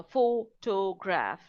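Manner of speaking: PHOTOGRAPH drawn out slowly, PHO - to - graph; syllable by syllable. Shown as a demonstration. Teacher breaks down word stress for learners, slow syllables. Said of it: "that is what we mean by word stress".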